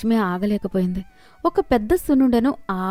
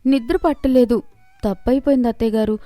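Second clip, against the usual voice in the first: apologetic pleading; 4 semitones higher than usual. Acted. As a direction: beg forgiveness after being caught. Wife apologizes to in-laws.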